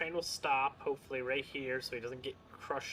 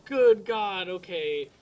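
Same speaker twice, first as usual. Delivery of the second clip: exasperated; 7 semitones higher, 13 dB louder, slower than usual. Pretend. Recovering after the train hit.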